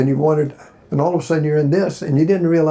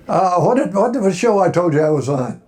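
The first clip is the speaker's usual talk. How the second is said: normal talking voice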